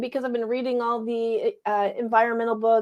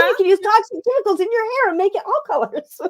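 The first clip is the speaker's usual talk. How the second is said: mock cheerful; 11 semitones higher, 5 dB louder, faster than usual. Real, not acted. Ironic high fast aside.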